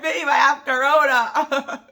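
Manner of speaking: high, loud playful announcement; a hammed-up warning to her husband; mock dramatic confession. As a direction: tease him like it's big news. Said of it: "I'm like".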